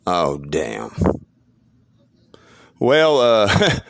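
normal talking voice

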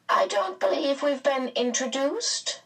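high, formal character voice; Mrs Whatsit. A narrator's reading. Mrs Whatsit greeting Mr Murray, high and formal. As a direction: make a polite, high-pitched social remark.

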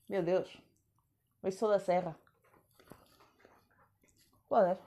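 talking while chewing tangerine; words round a mouthful; mouth full. Real, not acted. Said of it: "isto não se pode comer enquanto se grava".

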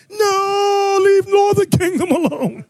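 high, laughing mock plea; laughing protest. Real, not acted. Laughing plea after teasing the brothers.

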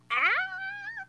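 sudden thrilled high squeal. Real, not acted. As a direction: squeal with delight. Squealing over Belle.

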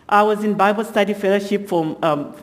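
usual speaking voice

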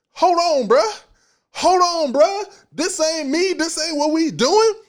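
high, loud rallying cry; rising shout. Acted. Preacher to the congregation, shouting himself awake. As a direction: snap yourself out of it, fired up.

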